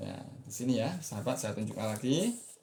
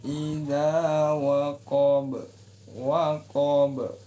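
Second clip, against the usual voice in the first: waqab chanted with the pitch lifted; rising waqab; 4 semitones higher, 7 dB louder, much slower than usual. Shown as a demonstration. Teacher isolating the rise on waqab for students. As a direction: raise the note on waqab. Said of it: "Naik, ya".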